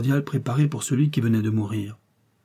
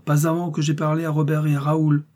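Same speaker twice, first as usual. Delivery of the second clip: raised, sustained objection; insistent protest; 5 semitones higher than usual. A narrator's reading. Bandit demanding to speak to the brothers, insistent. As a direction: dig in and demand a hearing, pitched higher.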